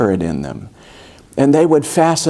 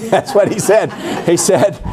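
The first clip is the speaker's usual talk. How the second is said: laughing; 7 semitones higher than usual. Real, not acted. Laughing at the anecdote.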